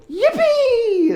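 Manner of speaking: very high, loud imitated squeal of joy; hammed-up gleeful whoop; kids' cheer. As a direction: cheer like overjoyed children, drawn out. Kids celebrate cancelled sparring.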